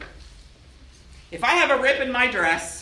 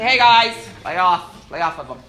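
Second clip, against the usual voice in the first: louder firm "lay off"; defending the kid, raised voice; mock call-out; 2 semitones lower, 8 dB louder than usual. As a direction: call the bullies off. Defending someone mistreated.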